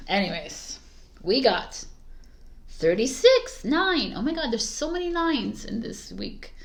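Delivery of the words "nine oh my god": normal talking voice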